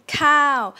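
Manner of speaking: "cow" loud with a clear drop; falling tone. Shown as a demonstration. Teacher repeats the falling tone, loud dropping "cow". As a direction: punch "cow" and let it fall. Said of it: "falling tone".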